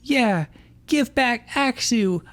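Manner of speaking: shrill-pitched demand dragged out; yelling. Acted. Iris demanding Axew back from Ash, yelling high. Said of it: "Iris yelled".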